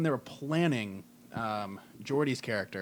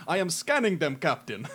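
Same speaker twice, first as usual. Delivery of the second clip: put-on Jamaican accent; 3 semitones higher, 4 dB louder than usual. Geordi voiced as Jamaican.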